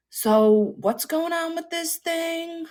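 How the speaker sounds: high, slow puzzled questions; confused character. Retold or imitated. Boyfriend's character asking, high and slow. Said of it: "he was constantly just like".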